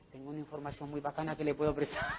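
fast, low, hushed aside; confidential offer. Acted. Recruiter privately lending information to a guest, hushed and confidential. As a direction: murmur the offer privately and quickly. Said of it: "no le hablo enfrente de los demás".

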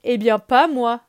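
put-on loud, insistent boy; insisting. Mowgli insisting to Shere Khan he's not afraid, loud. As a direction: stand your ground, loudly. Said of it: "insiste Mowgli".

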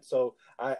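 ordinary talk

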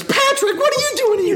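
loud falsetto exclamation; very high, loud cartoon voice breaking into laughter; imitated shocked cartoon outcry. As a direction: squeal it in mock astonishment. Acts SpongeBob exclaiming at Patrick.

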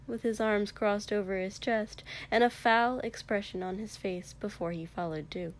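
plain reading voice

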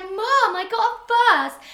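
high, loud, slow cry of triumph; joyful shout. Retold or imitated. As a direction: shout the news down the phone. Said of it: "And then I was like".

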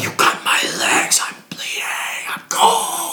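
breathy whispered wail; whispered screaming. Acted. Told to stop yelling, still plays dying, whispering. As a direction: keep screaming, but in a whisper.

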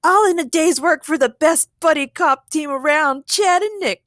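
high, loud swaggering brag; projected, self-congratulating boast; boastful. Acted. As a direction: show off like a hero. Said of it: "Chad boasted".

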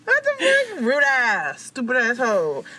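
high squealed indignant insults; mock outrage. Speaker ranting at rude celebrity, high-pitched mock outrage. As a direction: shriek it in hammed-up indignation.

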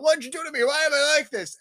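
high, hammed-up anguished demand; mock distress. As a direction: protest like a melodramatic wronged hero. Hero confronts in retelling.

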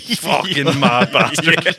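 slow, drawn-out disbelief; amused disbelief. Retold or imitated. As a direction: stretch it out, stunned and amused. Reacting to sudden engagement.

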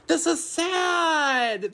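high, slow, stretched-out lament; exaggerated mock sadness; mock wail. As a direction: wail it like a melodramatic mourner. Reacting to sad scene.

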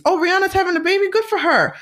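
quick high mock enthusiasm; brisk mock cheer. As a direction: rattle off the good news lightly and move on. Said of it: "In the same sentence".

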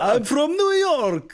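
squeaky mock New Yorker, stretched out; New York accent. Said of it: "You sounded like a vampire then".

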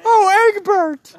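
high, loud, drawn-out mock parent cry; imagined gushing parents. Parents greeting their newborn son, high mock cry. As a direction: crow it high and long like doting parents. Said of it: "his parents were like".

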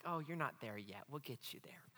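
soft, low mock comforting; hushed, condescending aside; patronising reassurance. Church members treating someone as a project, softly patronising.

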